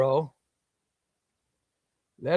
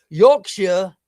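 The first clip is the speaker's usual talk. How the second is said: put-on tentative Yorkshire accent; 4 semitones higher, 6 dB louder than usual. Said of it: "have you got a Yorkshire impression".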